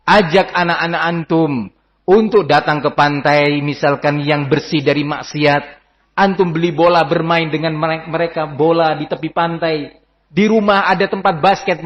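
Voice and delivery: usual speaking voice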